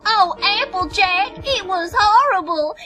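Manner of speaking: hammed-up whiny Southern drawl, high and slow; fake-cry in an exaggerated Southern accent; mock Southern accent. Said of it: "mockery of Apple Bloom's accent".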